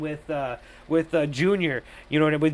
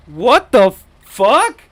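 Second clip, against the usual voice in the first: slow, louder exasperated disbelief; incredulous; 3 semitones higher, 9 dB louder, much slower than usual. Real, not acted. Reviewer reacting to the silly motive, loud disbelief. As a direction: throw up your hands in disbelief.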